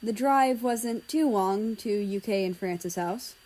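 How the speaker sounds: ordinary delivery, nothing marked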